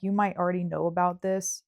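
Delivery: normal talking voice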